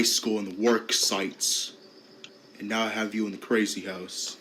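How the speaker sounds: a mocking teacher talking down to a patient; low bully teacher. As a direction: taunt him low and smug like a cruel teacher. Voices a taunting bully teacher.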